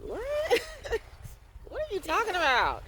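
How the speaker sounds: shrieking, very high disbelief; incredulous. Retold or imitated. Retelling her shocked reply to commenters demonizing water, squealing. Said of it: "And I'm like".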